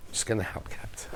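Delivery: hushed aside to the crew; whispered aside. Real, not acted. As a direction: whisper it off to the side so viewers barely catch it.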